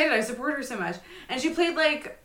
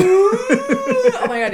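laughing; 10 dB louder, much slower than usual. Real, not acted. Laughing delight at Pocahontas joke.

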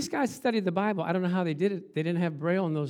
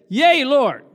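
loud, higher 'Yea, Lord'; emphatic affirmation; 6 semitones higher, 10 dB louder than usual. Acted. Blind men answering Jesus, loud and emphatic. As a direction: answer with full-voiced conviction.

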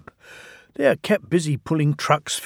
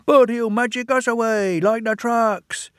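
high, worried little engines; aghast twins; 10 semitones higher than usual. Acted. The twins worrying to their drivers, high and anxious. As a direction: fret about vanishing. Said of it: "were aghast".

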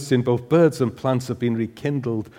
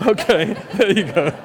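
1 laughing; 7 semitones higher, 4 dB louder than usual. Real, not acted. Laughing at a heckler.

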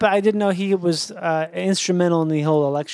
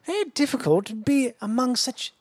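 slow, higher posh British aristocrat voice; 5 semitones higher, 3 dB quieter, slower than usual. Acted. Showing friends his new voice, a slow high British aristocrat. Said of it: "a British aristocrat".

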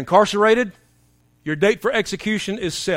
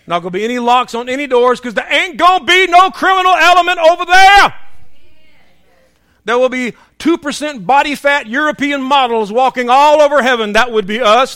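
loud, high, building pulpit cry; rousing preaching; 5 semitones higher, 6 dB louder than usual. Real, not acted. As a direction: whip up the congregation about heaven. Preaches at full force.

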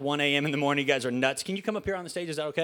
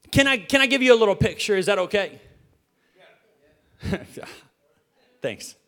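ordinary delivery

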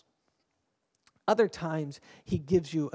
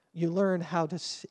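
usual speaking voice

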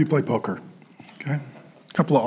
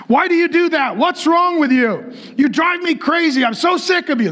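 higher-pitched mock scolding of a kid; imitated yelling dad; 6 semitones higher than usual. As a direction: act out an exasperated dad yelling, without full volume. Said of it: "Just literally yelling at the kid".

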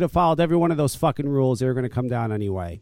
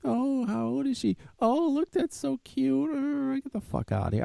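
put-on high, gushing baby talk that snaps into a grumble; mock cooing, then a sudden curse; 11 semitones higher than usual. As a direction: coo at the baby, then tell them off. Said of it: "It's like".

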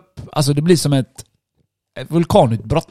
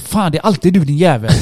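higher, exasperated cursing; acted-out angry accusation; retold anger; 4 semitones higher, 3 dB louder than usual. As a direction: re-enact fuming at the repeat offender. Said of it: "därför blir jag extra arg".

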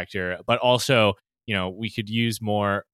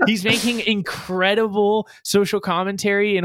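high, louder incredulous voice; amazed disbelief; 10 semitones higher, 4 dB louder than usual. Retold or imitated. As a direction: marvel at it, pitched way up. Recalls amazed hype about the director.

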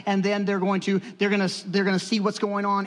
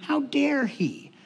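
offended; 3 semitones lower, slower than usual. Retold or imitated. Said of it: "I was like".

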